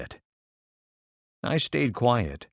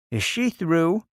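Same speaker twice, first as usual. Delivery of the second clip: higher, fretful mother asking; a fussy mother querying, pitched up; mother's voice; 8 semitones higher than usual. Acted. Mother's voice, read aloud.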